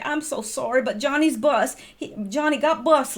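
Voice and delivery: a hint of a sorry Wilkes County parent, Southern accent; apologetic Southern parent. Supervisor imitating a late employee's Southern excuse. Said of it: "they'd say".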